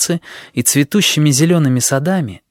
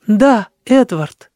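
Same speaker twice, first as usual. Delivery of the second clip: tender; 8 semitones higher, 3 dB louder, much slower than usual. Acted. Sheila answers tenderly.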